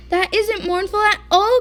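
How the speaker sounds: loud, high protesting cry; cried out. A narrator's reading. Bear protesting the banjo, loud high cry. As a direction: cry it out in outrage. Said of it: "cried Bear".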